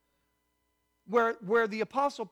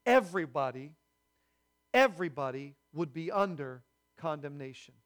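ordinary talk

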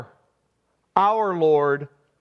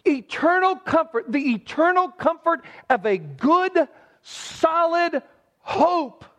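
higher, urgent preaching push, stretching solid hope; impassioned preaching; 10 semitones higher than usual. Acted. Pastor urging the congregation to think of the gift, impassioned preaching. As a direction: drive it home like a fired-up preacher.

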